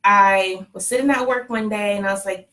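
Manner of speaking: normal talking voice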